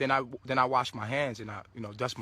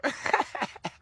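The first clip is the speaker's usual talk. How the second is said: breathy unvoiced laugh; 10 semitones higher than usual. Real, not acted. Laughing at the story.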